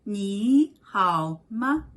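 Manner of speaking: 你好吗 drawn out slowly, each syllable separate; 你好吗 said slowly and deliberately, tone by tone; slow, clear model. Shown as a demonstration. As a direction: spell out 你好吗 slowly for learners. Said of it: "So you say".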